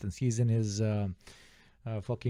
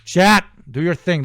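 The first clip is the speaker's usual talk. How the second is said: loud, higher-pitched call to action; rallying shout; 6 semitones higher, 9 dB louder than usual. Real, not acted. Streamer urging chat to make a million dollars, rallying shout.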